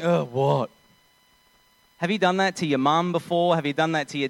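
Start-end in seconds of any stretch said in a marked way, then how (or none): 0.0-0.7 mock exasperated teen reply; arrogant teenager. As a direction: huff it out, imitating a put-upon kid. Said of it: "Like an arrogant, pimply, thirteen to fourteen-year-old".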